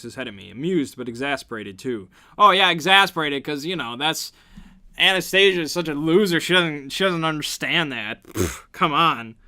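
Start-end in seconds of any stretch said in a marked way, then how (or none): 2.4-8.2 loud, high mocking rant; mock the character's attitude; sarcastic mocking. Real, not acted. 8.2-9.4 slow disbelieving pfft; scoffing. Real, not acted.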